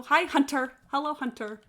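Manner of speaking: ordinary talk, nothing marked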